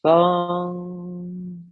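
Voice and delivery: usual speaking voice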